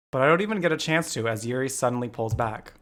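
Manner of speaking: usual speaking voice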